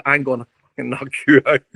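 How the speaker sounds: mock threat broken by laughter; half-laughing quote of a drunk threat; laughing through threat. Retelling a drunk guest's threat, laughing. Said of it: "started swearing".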